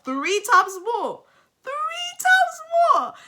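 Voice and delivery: high, loud, drawn-out disbelief, climbing to a squeal; incredulous. Real, not acted. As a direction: stretch it out in rising, squealed disbelief. Incredulous reaction to the income gap.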